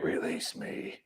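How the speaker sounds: breathy whispered alien command; eerie whisper. Retold or imitated. Alien speaking through the president.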